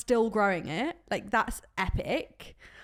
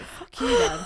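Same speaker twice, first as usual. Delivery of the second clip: mock retort; 11 semitones higher, 3 dB louder, slower than usual. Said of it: "I was like".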